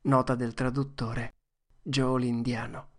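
ordinary delivery, nothing marked

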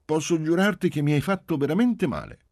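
put-on high, loud, solemn child voice; insistent high-pitched oath; judicious little girl. A narrator's reading. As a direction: swear it in earnest, like a sensible child. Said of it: "ella disse giudiziosamente".

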